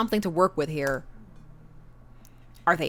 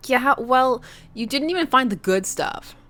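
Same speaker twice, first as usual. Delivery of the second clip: ordinary talk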